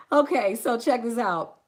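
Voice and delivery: ordinary talk, nothing marked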